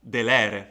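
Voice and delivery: "delere" with a loud, long middle LE; stress on penult. Shown as a demonstration. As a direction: lean hard on the middle syllable of "delere". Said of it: "the accent must fall there".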